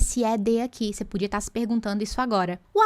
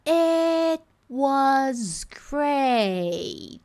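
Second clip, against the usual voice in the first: drawn-out, very slow English phrase; slow motion; 3 semitones higher, much slower than usual. Shown as a demonstration. Teacher replays the phrase to students in slow motion. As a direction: stretch each word as in slow motion. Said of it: "coloco em slow motion".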